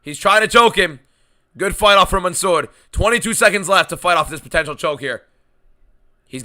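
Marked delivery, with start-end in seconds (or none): 0.0-5.2 louder, higher urgent call; excited play-by-play. Real, not acted. Live fight play-by-play.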